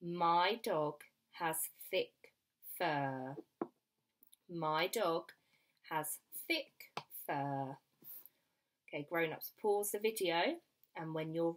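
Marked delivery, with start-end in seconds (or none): 0.0-7.8 slow, word by word; slow dictation. Shown as a demonstration. Teacher gives children a sentence to write, slow dictation. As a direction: dictate it slowly with gaps for writing. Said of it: "sentence I would like you to write".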